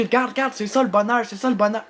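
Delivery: very high, loud, thrilled cry; excited pointing out. Acted. Showing the little one happiness passing by, excited high cry. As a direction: cry out for the kid to look. Acted excited call to the child.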